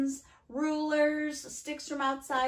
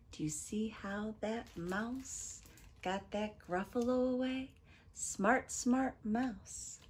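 soft storyteller aside, near a whisper at first; hushed wrap-up; 3 semitones lower, 6 dB quieter than usual. Real, not acted. Reader asks children about clever mouse, hushed.

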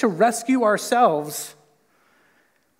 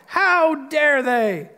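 slow, high, loud indignant gasp; mock outrage; 7 semitones higher, 4 dB louder, much slower than usual. Preacher mocking judgmental listeners, slow hammed-up outrage. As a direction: drag out the outrage, hamming it up.

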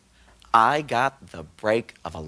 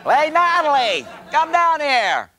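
calling out; 13 semitones higher, 5 dB louder than usual. Acted. Calling Natalie down the stairs.

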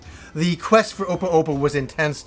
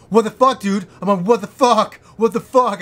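higher, louder yell at a friend; retold yell; 4 semitones higher, 4 dB louder than usual. Yelling at his friend over the phone about lost items. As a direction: blast it down the phone line.

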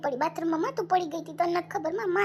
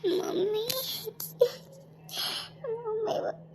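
higher, weepy cry for mother; tearful calling; 5 semitones higher, 4 dB quieter, much slower than usual. Acted. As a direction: call out to mum through tears. Sick daughter calls mother, crying. (રડતાં રડતાં માને બોલાવવું)

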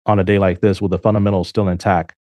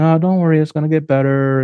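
mock reassurance; 5 semitones higher than usual. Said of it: "just sitting there saying".

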